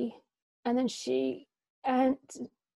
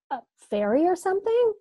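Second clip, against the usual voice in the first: higher, louder scoffing question; mocking; 9 semitones higher, 8 dB louder than usual. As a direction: tease her with disbelief. Mocking Kate's angel idea.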